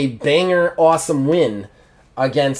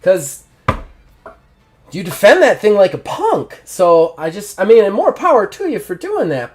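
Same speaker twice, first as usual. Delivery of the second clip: high, airy mock innocence; smug taunt; 5 semitones higher than usual. Rival innocently taunts the champion, high-pitched. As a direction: taunt him while playing innocent. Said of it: "Manchurian goes".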